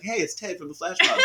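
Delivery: high, comic acquaintance voice; mock friendly greeter. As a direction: imitate an overfamiliar greeting through laughter. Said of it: "he's like".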